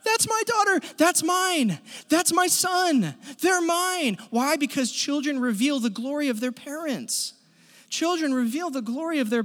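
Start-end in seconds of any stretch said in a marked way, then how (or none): 0.0-4.2 higher and louder, proud and beaming; proud parent. Retold or imitated. A proud dad at the game, louder and higher, beaming. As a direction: cheer your kid on from the stands. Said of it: "No, what a parent does is".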